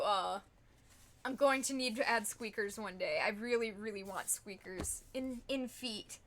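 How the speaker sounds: ordinary delivery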